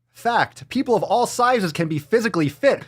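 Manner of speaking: high sarcastic reading of the fact; mocking read. Vlogger reading a weight post aloud, high and mocking. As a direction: read it up high, full of scorn.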